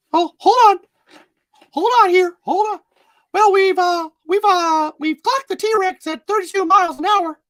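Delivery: very high squeaky falsetto mouse; Mickey Mouse. Acted. Park host greeting visitors to Jurassic Park, Mickey Mouse voice. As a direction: pipe it up high and loud, like a cartoon mouse. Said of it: "Mickey Mouse welcoming you to Jurassic Park".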